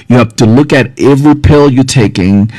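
ordinary delivery

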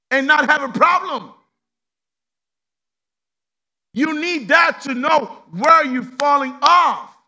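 loud, high preacher's build to a shout; preaching at full force. Acted. Preacher pressing the church on sin, preaching hard. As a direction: drive it home to the congregation.

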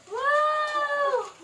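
put-on high, drawn-out wow for the baby; exaggerated wow. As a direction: sell the surprise to the toddler. Mock amazement for toddler.

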